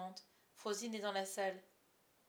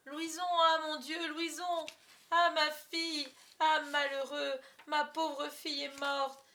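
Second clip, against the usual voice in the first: high, loud, panicked stage lament; alarmed cries turning into drawn-out, hammed-up mourning; melodramatic grief; 7 semitones higher, 8 dB louder, slower than usual. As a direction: wail theatrically over a dead daughter. Father acts grief over child.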